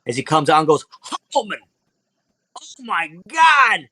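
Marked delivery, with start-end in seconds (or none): 1.3-3.9 father thrilled to see his son; overjoyed father. Acted. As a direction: stretch it out in delighted disbelief. Father greets his rescuing son.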